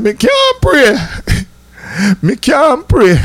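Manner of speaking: high, slow, stammering mock plea; nervous friend's high mock voice; imitating nervous Howard. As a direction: squeak it out like a scared boy who can't pray. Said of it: "Howard said".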